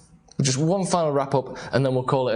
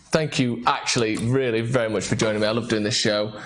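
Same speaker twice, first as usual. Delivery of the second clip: ordinary talk, nothing marked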